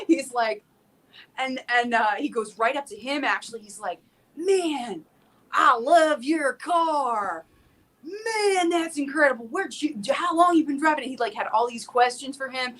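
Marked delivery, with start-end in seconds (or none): 4.4-11.1 higher, hammed-up Texas country-man voice; Texas tractor man. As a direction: imitate an impressed Texan, drawn out on "love your car". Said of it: "this guy with absolutely no teeth".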